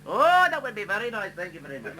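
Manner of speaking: high, sing-song Indian accent, hammed up; mock Indian accent. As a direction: play the Indian girl, sweetly and politely, in an Indian accent. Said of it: "beautiful Indian girl".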